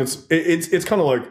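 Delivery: usual speaking voice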